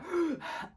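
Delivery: sharp high imitated gasp; inhaler gasp. Runner trying her cousin's inhaler, sharp mock gasp. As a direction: suck in air as from an inhaler.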